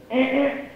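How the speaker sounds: high car-horn honks; imitated car horn. Dad honking in traffic, imitated horn.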